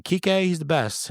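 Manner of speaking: high, drawn-out protest; pleading defence. Retold or imitated. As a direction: stick up for your favourite, stretching it out. Acted plea in retold text argument.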